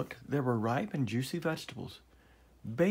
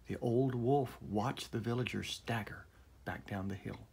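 plain reading voice

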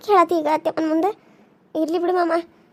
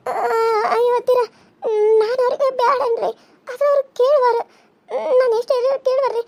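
higher, tearful whining plea; crying plea; 6 semitones higher than usual. Acted. Younger wife crying to her mother-in-law, high tearful plea. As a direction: wail your excuse through tears.

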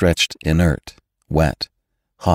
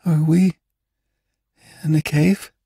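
put-on high, halting girl's voice; faltering high-pitched question; weak girl; 10 semitones higher than usual. A narrator's reading. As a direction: ask haltingly, with a long break midway. Weak girl waking in the dark.

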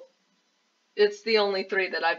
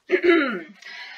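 throat clearing; 5 semitones higher, 5 dB louder than usual. Real, not acted. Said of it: "Excuse me".

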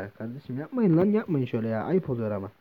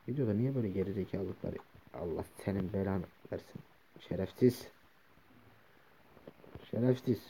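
lower, much softer grumbling; low muttered cursing; 6 semitones lower, 10 dB quieter than usual. Real, not acted. Player muttering curses at the mobs, low and soft. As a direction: curse under your breath.

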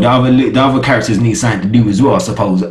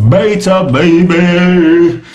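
hyped call-out; 4 semitones higher, much slower than usual. Shown as a demonstration. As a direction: sell the name like an announcer. Hype repeat of name.

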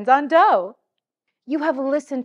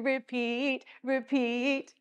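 softer echo; 2 semitones higher, 9 dB quieter, slower than usual. Shown as a demonstration. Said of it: "do it again".

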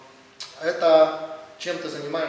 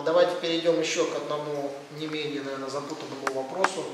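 normal talking voice

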